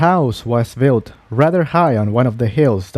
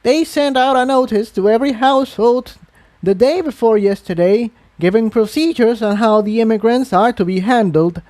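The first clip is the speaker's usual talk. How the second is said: put-on much higher, officious elderly voice; old mare explains royal procedures; prim old mare; 9 semitones higher than usual. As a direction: recite the rules dutifully. Old mare recites the rules.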